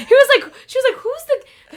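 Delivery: high, loud, laughing restarts; trying to talk through giggles; real laughing fit. Real, not acted. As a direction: crack up mid-story, voice shooting up. Laughing too hard to tell story.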